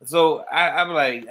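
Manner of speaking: normal talking voice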